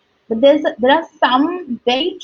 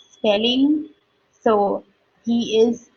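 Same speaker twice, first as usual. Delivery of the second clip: ordinary delivery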